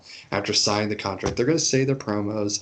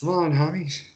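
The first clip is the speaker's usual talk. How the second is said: higher put-on buddy voice on "Come on, homie"; mock homie; 6 semitones higher than usual. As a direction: slip into a put-on pal voice. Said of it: "constantly saying".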